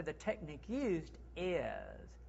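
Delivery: slow, soft and polished; refined phrasing. Shown as a demonstration. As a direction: say it like a proud craftsman. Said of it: "How's that sound? Better?".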